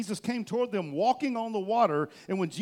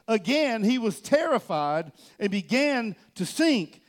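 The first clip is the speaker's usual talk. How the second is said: ordinary delivery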